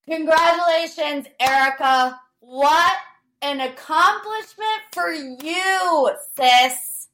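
high, drawn-out sarcastic praise; mock congratulations. Podcaster sarcastically congratulating Erika on her tweet, mock gushing.

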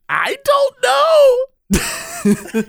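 very high, long-drawn mock bewilderment; exaggerated cluelessness. Seatmate signalling to puzzled passenger, exaggerated cluelessness. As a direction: stretch it out into a squeaky, helpless shrug.

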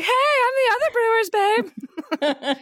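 hammed-up starstruck fan; mock fangirl. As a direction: gush like an overeager fan. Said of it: "I can be like".

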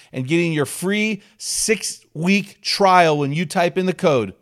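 upbeat, loud sponsor read; pumped podcast ad voice. Acted. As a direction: sell it like a radio spot. Host reads sponsor ad.

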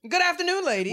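a hint of a stately fashion icon; mild imitation of a grand gentleman greeting ladies; Andre Leon Talley impression.

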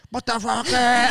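high, loud mock stage entrance; retold roar to fire up a crowd; high-energy hype yell. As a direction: burst out with over-hyped energy. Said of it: "like you say, with that energy".

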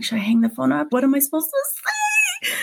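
rising into a high panicked squeal; flustered retold freak-out, ending very high. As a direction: reenact panic, pitch shooting up at the end. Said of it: "I was really freaking out at that point".

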